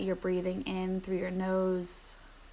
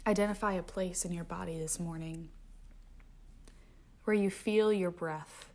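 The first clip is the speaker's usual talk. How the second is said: softer meditation voice sinking to whispers; guided meditation; 7 dB quieter than usual. Acted. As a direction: guide the breath, letting phrases fade to a whisper. Returns to guided breathing.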